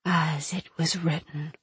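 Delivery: slow, soft ghostly whisper; eerie whisper. A narrator's reading. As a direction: breathe it like a many-voiced spirit. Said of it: "the oracle whispered".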